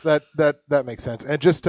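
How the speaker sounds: ordinary talk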